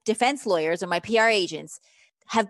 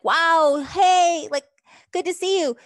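higher, louder mock-friendly hello; delighted greeting; 8 semitones higher, 4 dB louder, slower than usual. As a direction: act pleasantly surprised to bump into someone. Imagined friendly run-in with an ex.

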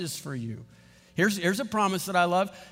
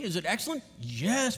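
ordinary delivery

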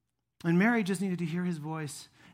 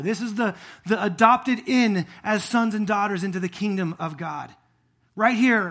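loud, ringing preacher's voice; fervent preaching; 5 semitones higher, 12 dB louder than usual. Acted. Pastor proclaiming the resurrection body to his congregation, full force. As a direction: preach it out to the whole room.

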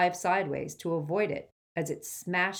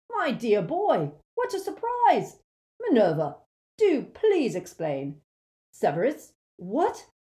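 puffing, flustered surprise; panting Slughorn; 6 semitones higher, 6 dB louder than usual. Acted. Slughorn arriving out of breath, flustered and panting. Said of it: "he panted".

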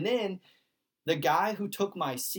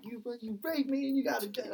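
retold yelling; 8 semitones higher, 4 dB quieter than usual. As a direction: imitate his rant high without raising the volume. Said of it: "still just yelling at him".

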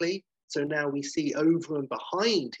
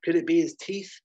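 lightly acted mother, higher voice; mother asking doctor; 5 semitones higher, 4 dB louder than usual. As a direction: lift the question higher as the mother. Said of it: "And Alex is like".

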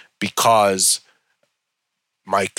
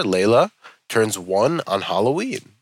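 usual speaking voice

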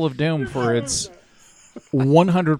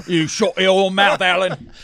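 raised, hammed-up British scolding; mock British accent; 4 semitones higher, 3 dB louder than usual. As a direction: tell him off in put-on British. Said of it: "You guys all sound like".

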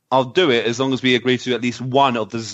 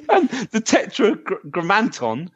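laughing; 8 semitones higher, slower than usual. Real, not acted. Laughing while reading next commenter's name.

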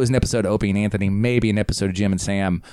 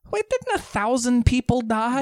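shocked realization; 13 semitones higher than usual. Retold or imitated. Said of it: "But then you go like".

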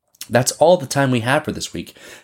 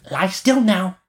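put-on high, bossy little girl voice; robber girl; 9 semitones higher, slower than usual. A narrator's reading. Robber girl orders Gerda to lie still, bossy. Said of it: "the rubber girl said".

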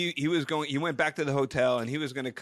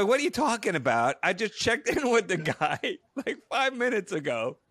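higher-pitched, incredulous retort; indignant protest; 7 semitones higher than usual. Retold or imitated. Guest insists to receptionist he just checked in, indignant. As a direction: protest in mock disbelief.

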